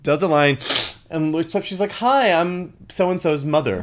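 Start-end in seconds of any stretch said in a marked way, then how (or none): 1.9-3.8 slow, higher, chirpy polite hello; mother's cheery greeting. Retold or imitated. The mother looks up from a line, cheery and slow. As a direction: greet them brightly as if nothing is wrong. Imitating the mother doing coke.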